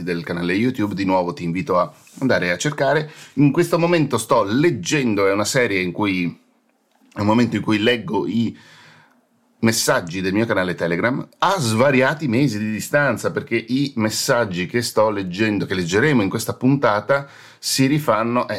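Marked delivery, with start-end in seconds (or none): none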